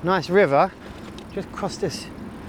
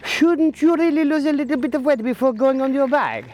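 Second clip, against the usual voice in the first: voicing the dogs, higher and mock-judgmental; judging dog; 7 semitones higher than usual. Dogs staring at the cyclist, mock-judgmental voice. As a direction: play the dogs sizing up a heavy cyclist. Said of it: "who look at me like".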